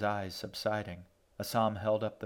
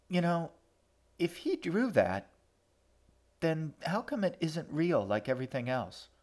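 put-on light, higher girl's voice; young girl voice; 7 semitones higher than usual. A girl challenging Assam in a light voice.

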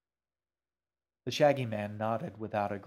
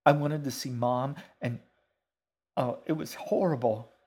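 lightly voiced distressed girl, a little louder; a touch of horror, pitch slightly raised; upset girl; 4 semitones higher, 5 dB louder than usual. Girl recounts a horrible sight.

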